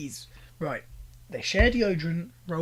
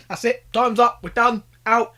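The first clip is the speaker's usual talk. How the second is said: loud, higher mock referee calling time; playful shouted time's up; mock alarm call; 5 semitones higher, 11 dB louder than usual. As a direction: bark it like a buzzer going off.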